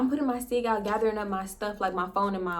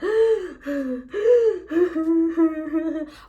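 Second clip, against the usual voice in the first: loud gasping mock sobs; sobbing gasps; 8 semitones higher, 5 dB louder than usual. Retelling how the crying friend gasped and sobbed, loud gasping sobs. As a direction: gulp air like a bawling friend. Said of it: "bawling her eyes out".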